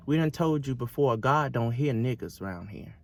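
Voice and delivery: low, soft contemptuous sneer; sneering son. Retold or imitated. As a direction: talk down to her, low and cold. Master's son quoted.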